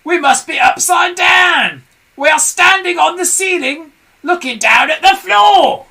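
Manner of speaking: high, loud panicked shouting; Mr Twit alarmed. A narrator's reading. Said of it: "gasped Mister Twit".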